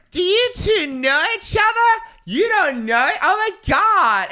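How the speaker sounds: high, loud hammed-up shock; mock shocked gasp. Imitating Angie discovering they know each other, shrill surprise. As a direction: squeal it like a stunned friend.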